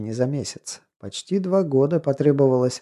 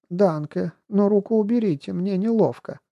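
put-on embarrassed young woman; 7 semitones higher than usual. Marta voiced by narrator.

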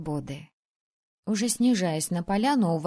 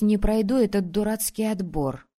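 ordinary delivery, nothing marked

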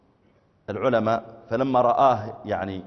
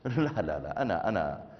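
lower, soft self-important murmur; a touch of vanity muttered; arrogant inner voice; 5 semitones lower, 7 dB quieter than usual. Pretend. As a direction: dismiss the humble invite. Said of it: "فقلت في نفسك".

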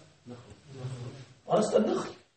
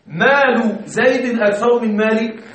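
slow example read; 2 semitones higher, slower than usual. Shown as a demonstration. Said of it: "وهنا".

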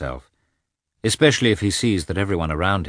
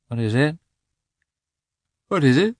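just-woken question, pitched higher; half-awake questioning; 9 semitones higher, 4 dB louder, faster than usual. A narrator's reading. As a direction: ask as if roused from sleep. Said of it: "he muttered, not yet quite awake".

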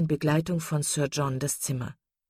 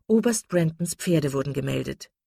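ordinary delivery

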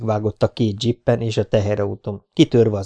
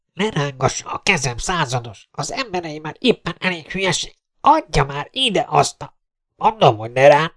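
irritated squabbling; 6 semitones higher than usual. Acted. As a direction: argue with someone grabbing at you. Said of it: "veszekedést, káromkodást recsegett". (ingerülten veszekedve)

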